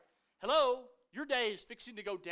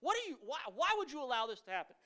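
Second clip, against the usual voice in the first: retold frustration at God; 5 semitones higher, faster than usual. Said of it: "I'm chapped at God".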